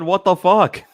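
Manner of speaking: loud, high, emphatic repeat; exasperated outburst. Real, not acted. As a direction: throw it back loudly in disbelief. Repeats outburst after being asked to repeat.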